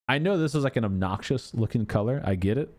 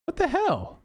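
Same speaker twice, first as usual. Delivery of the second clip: high-pitched incredulous outburst; baffled exasperation. Real, not acted. Streamer baffled by a missing accent color, high exasperated disbelief. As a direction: exclaim high in disbelief, dropping at the end.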